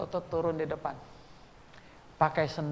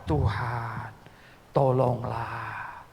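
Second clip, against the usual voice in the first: drawn-out plea; much slower than usual. Retold or imitated. A retold prayer, begging God.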